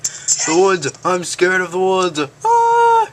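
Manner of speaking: high, frantic squeal of fright; scared horse voice. Retold or imitated. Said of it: "and she's like".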